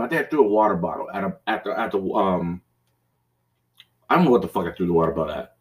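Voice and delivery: usual speaking voice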